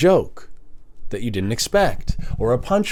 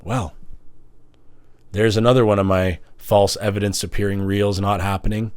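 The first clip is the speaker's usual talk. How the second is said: ordinary delivery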